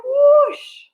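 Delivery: high, loud whoosh like a frog leaping. Shown as a demonstration. Teacher showing kids a frog leap, big whoosh. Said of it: "Can you jump high?".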